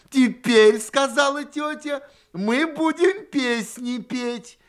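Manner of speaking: high, loud call, stretched at the end; rally the children to sing, high and loud; loud, high-pitched host's announcement; announcing loudly. A narrator's reading. Aunt announces the singing.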